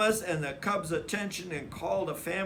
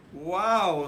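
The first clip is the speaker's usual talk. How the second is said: louder, satisfied exclamation; a tired but proud wow; impressed; 3 semitones higher, 7 dB louder than usual. A narrator's reading. Brother admiring the clean room, impressed.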